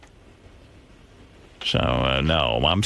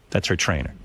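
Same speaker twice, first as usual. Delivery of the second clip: ordinary delivery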